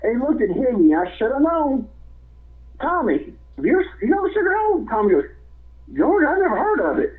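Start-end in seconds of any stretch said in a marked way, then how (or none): 2.8-4.9 high, quick conspiratorial ask; sly George to Tommy. Retold or imitated. George asks Tommy to play along, quick high sly voice. 6.0-7.1 high mock-innocent denial; Tommy playing dumb. Tommy feigns ignorance to George, high mock-innocent voice.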